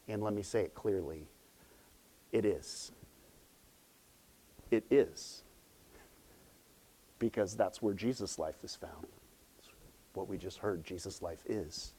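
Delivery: low, soft, with long pauses; hushed conviction. Real, not acted. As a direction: drop right down to let the point land. Preacher solemnly lands key point.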